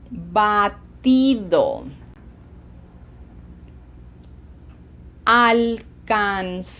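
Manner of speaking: slow, drawn-out Spanish verb forms; slow, deliberate teacher's model for repeating; slow pronunciation drill. Shown as a demonstration. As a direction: stretch every vowel, leaving gaps between words. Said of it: "don't shorten this I".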